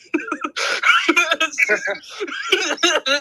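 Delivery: loud, high peal of laughter; long laugh. Real, not acted. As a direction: laugh hard at something absurd. Laughs at the hostile tweet quoted.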